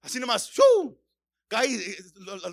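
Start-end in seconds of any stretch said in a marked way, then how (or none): 0.5-1.0 loud, high "shoo" imitating a rise; quick high whoosh noise; whoosh upward. Shown as a demonstration. Preacher showing how fast the cry rises, high whoosh. Shows sound shooting up.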